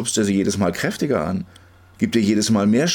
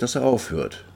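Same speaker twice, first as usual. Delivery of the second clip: plain reading voice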